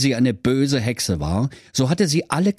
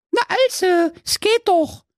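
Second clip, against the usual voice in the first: put-on much higher pleased girl voice; pleased sister. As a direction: praise him like a smug kid sister. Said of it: "freute sich dem Brudi seine Schwester".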